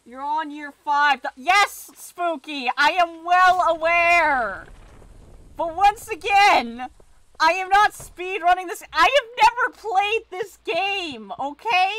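loud, high exasperated outburst; worked up. Real, not acted. Player snaps at Spooky over never having played, worked up. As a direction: fire back loudly, stretching the key words. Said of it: "for getting worked up".

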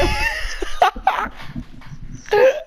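very high, sobbing-like laugh; screaming with laughter; shrieking laugh. Real, not acted. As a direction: laugh until it almost sounds like crying. Laughing at his own joke.

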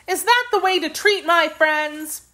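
put-on high, reproachful girl's question; indignant cry. A narrator's reading. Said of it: "cried Dorothy".

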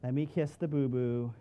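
a light take on a mom coddling a scraped knee; lightly voiced mother fussing over a boo-boo; doting mom. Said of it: "You know what mom would do?".

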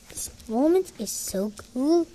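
usual speaking voice

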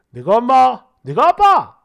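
hammed-up indignant outburst; indignant retort. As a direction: throw the words back loudly. Acted outburst at in-laws.

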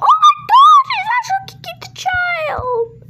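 very high, loud shriek falling into a wail; hammed-up horror, squealing; horrified shriek. Horrified by the kicking in story.

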